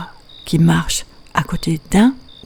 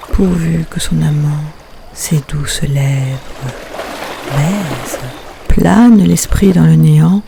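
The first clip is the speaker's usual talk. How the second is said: measured poem reading, phrase by phrase with pauses; poetry recitation; 4 dB louder than usual. Acted. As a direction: recite the poem line by line, letting each phrase stand apart. Recites her poem Tumulte.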